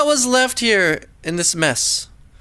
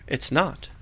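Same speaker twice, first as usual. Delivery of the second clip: quiet and grim; 5 semitones lower, 8 dB quieter than usual. A narrator's reading. Grim close.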